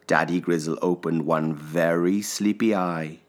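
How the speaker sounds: ordinary delivery, nothing marked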